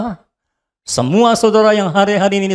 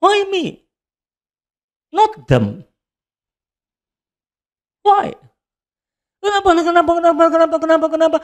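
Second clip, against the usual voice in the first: much higher, insistent repeated questioning; pleading why; 10 semitones higher than usual. Retold or imitated. Preacher retelling how he questioned God, high and pleading. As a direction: keep asking why in a high, desperate pitch. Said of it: "Lalu saya tanya".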